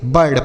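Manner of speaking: बर्ड with a short central vowel; correct reading. Shown as a demonstration. Teacher gives students the answer, बर्ड with short uh vowel. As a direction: say बर्ड, "burd", not with ee. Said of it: "क्या पढ़ेंगे?".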